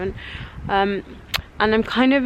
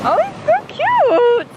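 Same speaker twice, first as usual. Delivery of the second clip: high, slow delighted squeal; excited squeal; slower than usual. Real, not acted. Squealing over a snow sculpture.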